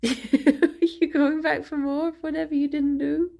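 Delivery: high, laughing pet talk to the dog; cooing at the pet. Real, not acted. Talking to her dog Fifi, cooing and laughing. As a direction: coo at her with a giggle.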